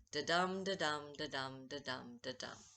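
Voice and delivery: "da-dum" with the dum hit hard, fading; da-dum beat. Shown as a demonstration. Teacher tapping out iambic meter for students, chanted beat. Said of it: "unstressed, stressed, unstressed, stressed".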